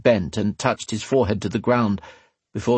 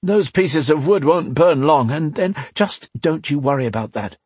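lightly voiced woman, higher pitch; brisk don't-worry dialogue, raised pitch; woman character reassuring; 7 semitones higher than usual.

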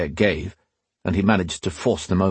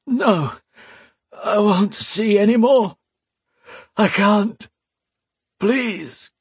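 high, slow pleading of a broken man; desperate Alesh; 12 semitones higher, slower than usual. Acted. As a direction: beg the gods to stop it.

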